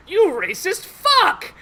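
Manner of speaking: high, drawn-out acted shout; outraged scream. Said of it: "and starts screaming at him in Drow".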